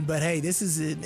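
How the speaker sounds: ordinary delivery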